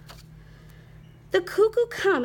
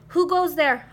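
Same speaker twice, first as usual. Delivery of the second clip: loud, raised challenge; hollering; 5 semitones higher, 7 dB louder than usual. A narrator's reading. Space traveler challenging a passing comet, hollering. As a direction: holler it out like a sentry. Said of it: "hollered Scapito".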